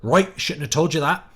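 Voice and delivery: a hint of a West Country groundskeeper accent; Hagrid country accent. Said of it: "Hagrid ... talks with a country accent".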